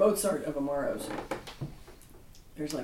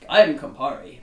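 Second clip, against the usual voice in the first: bold Campari voice; 5 semitones lower, 7 dB louder than usual. Pretend. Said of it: "Campari tends to be very, like".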